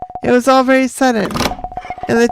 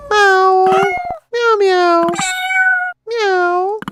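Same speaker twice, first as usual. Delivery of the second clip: lightly voiced cat, higher; cat meows; 9 semitones higher, much slower than usual. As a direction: meow back and forth like kittens. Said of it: "what they're saying to each other".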